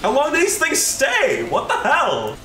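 high-pitched, incredulous complaint; real high yelp of protest; exasperated outburst. Real, not acted. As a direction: cry out in exasperation, voice shooting up. Repeats question in exasperation.

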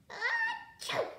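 huge, high-pitched storybook sneeze; acted sneeze. A narrator's reading. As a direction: burst out a loud mock achoo.

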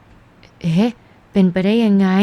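a sudden startled "eh", then mild disbelief. Acted. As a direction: caught off guard, asking how it can be. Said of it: "เสียงที่แสดงความประหลาดใจก็ดังขึ้น". (ตกใจแบบไม่อยากเชื่อ)

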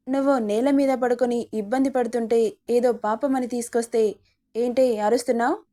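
ordinary delivery

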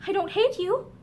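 usual speaking voice

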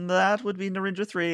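high-pitched officer confirming bad news; high, put-on crew member voice; ship's officer. Answers captain in character.

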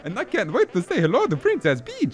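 high cartoon Italian brother voice; high-pitched, excited game sidekick; Luigi, eager. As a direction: chatter eagerly as a cartoon plumber.

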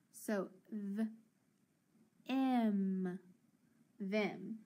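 th, then em, then blend to them; sounding out them. Shown as a demonstration. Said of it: "E is gonna be short".

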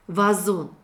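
normal talking voice

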